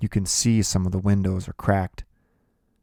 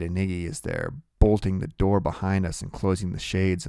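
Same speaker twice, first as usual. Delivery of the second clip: plain reading voice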